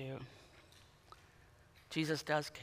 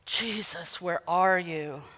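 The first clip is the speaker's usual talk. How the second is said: crying out; 9 dB quieter, slower than usual. Acted. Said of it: "some of them cried out".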